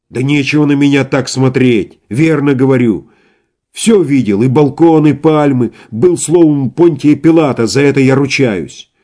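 heated insistence. Acted. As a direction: swear to it fervently. Иван доказывает, что видел Пилата. (горячо, с жаром)

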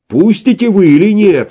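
high, loud threatening cry; furious shout. A narrator's reading. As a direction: roar at the orderlies. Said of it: "страшным голосом крикнул поэт". (страшным голосом)

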